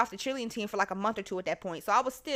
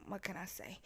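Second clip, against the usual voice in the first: very soft, whispered reply; sheepish whisper; 4 semitones lower, 18 dB quieter than usual. Retold or imitated. Caught student to the assistant principal, sheepish whisper. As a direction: answer under your breath, barely voiced.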